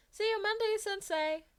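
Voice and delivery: high, loud cheery farewell; cheerful call. Acted. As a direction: call it out over the shoulder. Calling out cheerfully leaving.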